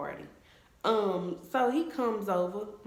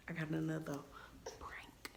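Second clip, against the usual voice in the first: whispering a teaser to viewers; whispered tease; 12 dB quieter than usual. Real, not acted. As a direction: let the viewers in on a secret. Teasing an upcoming prank.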